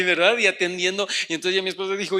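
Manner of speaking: ordinary delivery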